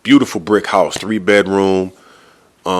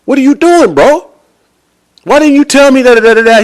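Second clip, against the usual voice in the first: high-pitched, raised-voice acted objection; loud exasperated protest; 6 dB louder than usual. Buyer confronting the seller outside the office, loud exasperation. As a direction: act out confronting a friend in disbelief.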